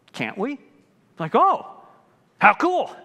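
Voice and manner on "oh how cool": mock believer. Mocking a careless believer.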